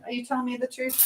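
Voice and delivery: normal talking voice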